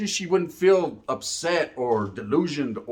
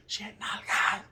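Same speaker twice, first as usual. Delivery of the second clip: hushed storybook whisper; whispered aside, barely any voice; bedtime whisper; 3 semitones higher, 9 dB quieter, slower than usual. Pretend. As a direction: breathe it like a secret at bedtime. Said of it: "We'll make it a fucking bedtime story".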